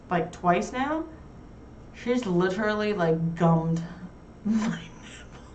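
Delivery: ordinary talk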